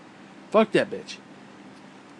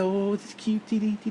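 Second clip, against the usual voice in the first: lightly mocked cutesy baby talk, higher pitch; 5 semitones higher than usual. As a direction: imitate sappy cooing with a little scorn. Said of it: "That cutesy bullshit".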